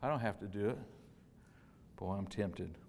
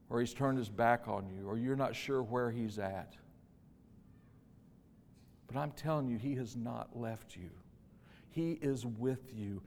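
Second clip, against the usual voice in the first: ordinary talk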